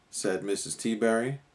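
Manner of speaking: ordinary delivery, nothing marked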